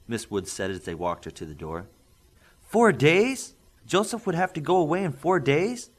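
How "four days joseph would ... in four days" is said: high, loud shocked question; loud alarm. Acted. Father shocked his son leaves so soon, loud and high. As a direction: blurt it out in disbelief. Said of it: "Mister Pringle asked loudly".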